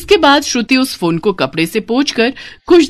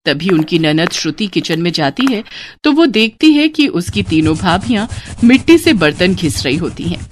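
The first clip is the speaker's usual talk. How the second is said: ordinary delivery, nothing marked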